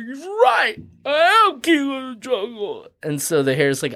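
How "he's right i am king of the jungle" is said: very high, puffed-up lion voice; proud lion. Retold or imitated. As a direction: crow it out, suddenly full of himself. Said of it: "And the lion's like".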